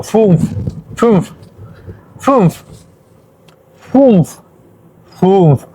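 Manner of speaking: "fünf" with German ü, not a plain U; ü unlike U. Shown as a demonstration. Teacher modelling for Spanish speakers, "fünf" with German ü. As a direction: repeat "fünf" as separate model words, umlauted ü. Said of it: "no suena tal como U, sino como".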